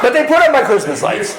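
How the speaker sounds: can't believe it, laugh it out; amused disbelief at the Christmas lights; incredulous laugh. Real, not acted. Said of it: "repeated with laugh".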